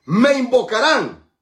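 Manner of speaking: loud, high, drawn-out proclamation; proclaiming scripture. Acted. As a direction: ring out the promise slowly. Preacher proclaims quoted verse.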